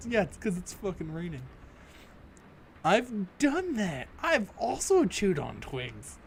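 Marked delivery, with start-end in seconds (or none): 2.8-6.2 slow amused read. Acted. Reading game line slowly, amused.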